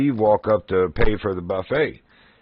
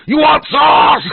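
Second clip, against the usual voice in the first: much higher and louder prank shout in a mock accent; shout out the window; 7 dB louder, slower than usual. Prankster shouting at passing students from a car window. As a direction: yell it at the students like the cashier did. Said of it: "I just rolled my window down".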